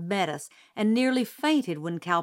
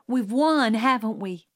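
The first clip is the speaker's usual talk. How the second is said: excited; 4 semitones higher, 5 dB louder than usual. A narrator's reading. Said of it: "Jim was jumping in excitement".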